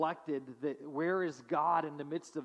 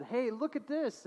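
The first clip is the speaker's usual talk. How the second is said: mom's voice; 8 semitones higher than usual. Retold or imitated. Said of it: "her mom brought, said".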